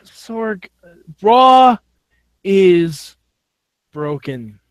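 long pause between each word; halting, deliberate pronouncement; word-by-word verdict. Real, not acted. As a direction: deliver it one word at a time, falling away at the end.

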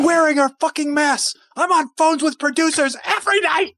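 high-pitched mock yelling at the crew; hammed-up yell, high and insistent; Tom Cruise rant. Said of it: "he's yelling at crew members".

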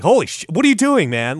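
high, loud, fast panicked yell; mock panic, shouting in horror at what he sees; freaking out. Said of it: "starts freaking out".

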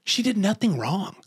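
high, pleading mock protest; hammed-up defense of the witch. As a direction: insist she's innocent, voice up high. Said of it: "I was going".

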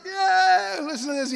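high, loud acted "yeah"; hammed-up excitement; gleeful cheer. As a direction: whoop it like a thrilled onlooker. Four grinning heads through roof.

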